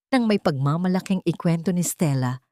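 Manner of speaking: ordinary delivery, nothing marked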